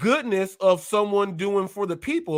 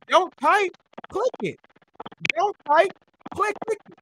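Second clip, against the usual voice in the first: high-pitched urging; urgent high pleading; 9 semitones higher than usual. Real, not acted. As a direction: push him to click, pitched up. Urging Pedro to click.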